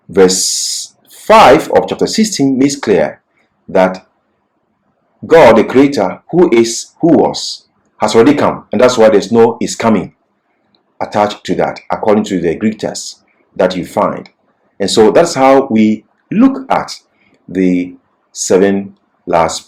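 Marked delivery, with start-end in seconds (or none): none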